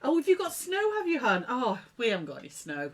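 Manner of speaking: ordinary delivery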